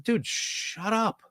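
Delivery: drawn-out exasperated shush-up. Retold or imitated. Host tells a rambling color analyst off, exasperated. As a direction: stretch it out, fed up, under your breath.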